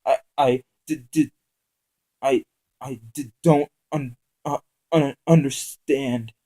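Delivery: frightened captive stammers to the gunman; whimpering. Acted. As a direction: stammer in terror. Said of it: "whimpered out the words".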